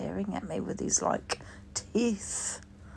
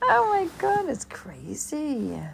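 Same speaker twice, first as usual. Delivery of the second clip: laughing; 9 semitones higher, 5 dB louder, slower than usual. Real, not acted. Laughing aftermath.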